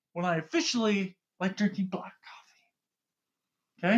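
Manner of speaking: dramatic hushed reveal; whisper. Acted. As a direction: whisper it like a big secret.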